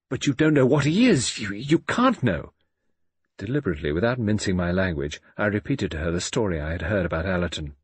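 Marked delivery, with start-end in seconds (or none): 0.1-2.5 high, raised protest, slowing at the end; agitated father. A narrator's reading. Father warning his daughter about the man, agitated. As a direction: insist urgently, stumbling on 'you'.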